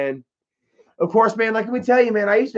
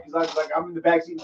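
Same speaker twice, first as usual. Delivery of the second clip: ordinary talk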